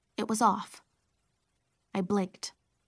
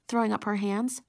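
ordinary delivery, nothing marked

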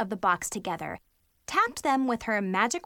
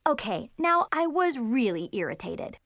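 usual speaking voice

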